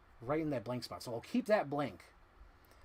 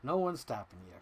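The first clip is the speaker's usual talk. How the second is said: usual speaking voice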